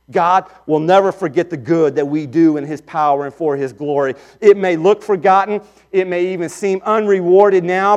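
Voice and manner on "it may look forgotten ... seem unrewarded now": preaching at full force. Acted. Preacher assuring the congregation that God is just, full-force preaching. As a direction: press the promise home from the pulpit.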